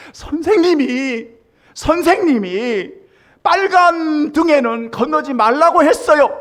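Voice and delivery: put-on high, loud, tearful little girl pleading; crying child. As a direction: wail it like a sobbing child. Crying child quotes teacher.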